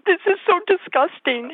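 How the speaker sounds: high mock child's disgust; little girl refusing meat, high and disgusted. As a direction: squeak it like a toddler spitting out meat. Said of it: "She would go".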